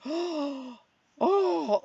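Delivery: high, rising gasping oh-oh of delight; daughter's delight. Retold or imitated. Daughter hearing about spreadsheets, a delighted gasping oh. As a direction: imitate the daughter's thrilled gasp. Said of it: "she was like".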